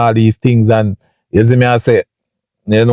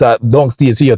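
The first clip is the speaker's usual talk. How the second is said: usual speaking voice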